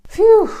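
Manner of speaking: relieved phew. Acted. Relief after long list.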